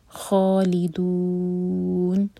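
very slow خالدون, final vowel held long; drawn-out خالدون; madd recitation. Shown as a demonstration. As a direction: stretch خالدون four counts. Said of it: "دو چار حرف کا لمبا".

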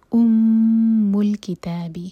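loud, slow chanted ام الکتاب with nasal hum on میم; slow recitation. Shown as a demonstration. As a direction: hold the میم in ام with ghunna. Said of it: "میم پر غنہ".

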